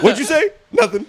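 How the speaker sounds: high, laughing back-and-forth of cop and sheepish reply; playful mini-skit. Pretend. Acting out the cop's challenge and his meek denial, high and laughing. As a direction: squeak it out through laughter, playing both sides.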